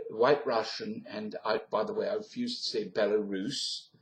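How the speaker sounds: usual speaking voice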